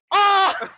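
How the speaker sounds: high loud mock squeal; robot squeal scream. ED-209 falling down the stairs, mock squeal. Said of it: "big squealing noises".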